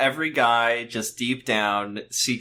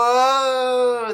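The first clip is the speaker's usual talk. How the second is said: mock whoa; 11 semitones higher, 5 dB louder than usual. Mock wonder at flying scene.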